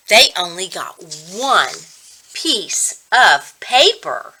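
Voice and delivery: ordinary delivery, nothing marked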